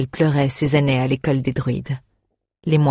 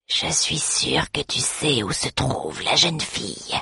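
cavernous undead voice; 4 dB quieter than usual. Acted. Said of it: "de sa voix caverneuse".

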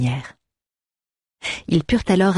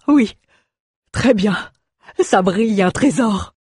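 excited dwarf; 5 semitones higher than usual. Acted. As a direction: savour the thought of gold. Dwarf dreaming of treasure.

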